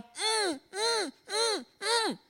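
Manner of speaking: high-pitched hummed pleas through a shut mouth; muffled cries. Retold or imitated. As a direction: imitate trying to speak with your mouth covered. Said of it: "the thing covered my mouth".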